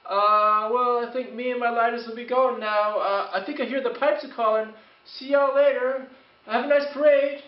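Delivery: nervous Irishman's brogue as he backs away; retreating Irish brogue. Retold or imitated. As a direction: back off in a hammed-up Irish brogue. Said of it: "He said something like".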